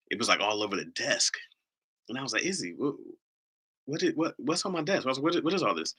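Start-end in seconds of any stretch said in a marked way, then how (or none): 2.5-5.9 higher-pitched retold bafflement; puzzled questioning. Dad asks Izzy about the mess on his desk, puzzled. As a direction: act out a dad's baffled questioning.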